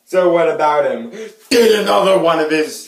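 very loud, high, overacted shouting; way too much. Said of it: "that is way too much".

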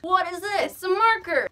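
high, loud, thrilled discovery; excited. Real, not acted. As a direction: burst out with delight. Excited about finding marker.

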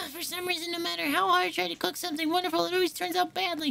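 put-on high, fussy young cook voice; high-pitched cook girl.

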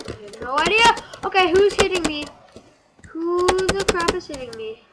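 high, loud frantic yelling; panicked shouting. Real, not acted. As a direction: shout at an unseen attacker. Attacked in game.

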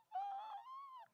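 thin, very high wheeze; strained high inhale; imitated choking gasp. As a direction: fight for air in a squeaky, soft gasp. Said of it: "That's what it sounded like".

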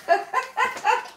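high burst of touched laughter; emotional laugh. Real, not acted. Moved by the letter, laughs.

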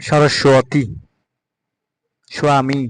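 সরস্বতী and স্বামী with a clear "swaa"; W sounded. Shown as a demonstration. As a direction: demonstrate w glides in সরস্বতী, স্বামী. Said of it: "এভাবে উচ্চারণ করতে হবে". (সরস্বতী, স্বামী-তে ব-ফলা "ওয়া")

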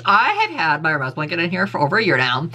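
ordinary delivery

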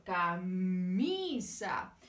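Camisa drawn out very slowly, ca-mi-sa; teacher modelling Camisa for the children, very slow and stretched; slow syllables. Shown as a demonstration. Camisa stretched out syllable by syllable. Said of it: "cuál es la sílaba".

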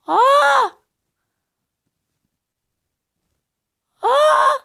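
high, loud shriek of alarm; panicked scream. Real, not acted. Gamer screaming when enemies close in, panicked scream. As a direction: scream as if caught off guard.

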